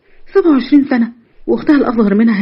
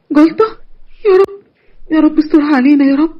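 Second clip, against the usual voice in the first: frightened pleading; 5 semitones higher than usual. Acted. خبر جلطة الأب.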